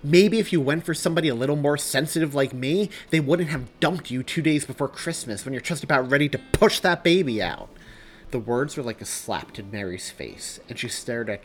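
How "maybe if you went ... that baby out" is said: loud, high, cruel comeback; raging, raised-voice tirade; enraged retort. Acted. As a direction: shoot back in a rage. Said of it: "shaking with rage now, shot back".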